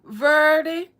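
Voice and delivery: loud, high grandma calling out a name; imitating a grandma calling across the house. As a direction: holler her name like a grandma from the porch. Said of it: "how your grandma used to call you".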